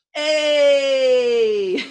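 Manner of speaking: high, loud joyful yell; cheering shout. Shown as a demonstration. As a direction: shout out a happy hey. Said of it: "And a final shout".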